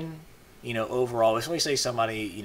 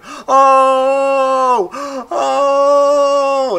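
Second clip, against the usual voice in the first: much higher, much louder whooping; excited crowd whoops; 14 semitones higher, 12 dB louder than usual. Retold or imitated. Viewers in a video reacting, loud excited whoops. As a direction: whoop like a crowd at a great point. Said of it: "just getting all excited".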